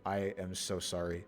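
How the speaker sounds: usual speaking voice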